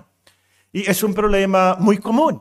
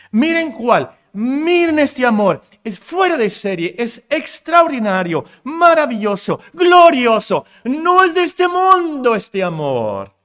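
building higher and louder to a peak; climactic exclamation; 7 semitones higher, 5 dB louder than usual. Acted. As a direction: preach it up to a rapturous shout. Preacher exclaiming God's love.

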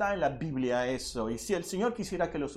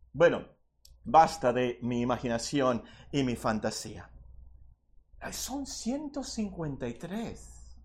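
ordinary delivery

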